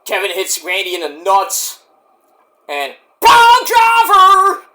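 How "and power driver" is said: commentator call. Pretend. Calling the big wrestling move.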